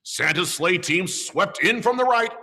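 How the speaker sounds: plain reading voice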